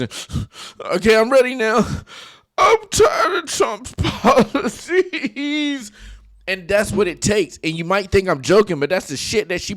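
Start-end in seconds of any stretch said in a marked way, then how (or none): 1.0-5.9 high, slow fake sob breaking into a laugh; mock crying. Mimicking Selena's fake crying on camera. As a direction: ham up a fake cry with a laugh. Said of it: "Started thinking about all the bad shit".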